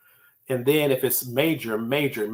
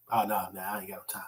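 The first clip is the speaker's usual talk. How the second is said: lower, softer, fast mumble of no; dismissive brush-off; 5 semitones lower, 10 dB quieter, faster than usual. Retold or imitated. Coworker refusing his YouTube video, fast soft dismissive brush-off. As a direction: wave the offer away quickly.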